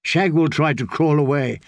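plain reading voice

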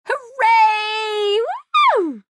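high squealed cheer, long and drawn out; gleeful high whooping. A narrator's reading.

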